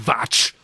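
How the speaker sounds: "watch" pronounced "vatch", with a V; German W. Retold or imitated. Host proving it is German, "watch" as "vatch". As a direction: mimic a German "watch" with a hard V. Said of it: "German accent 'cause he says watch".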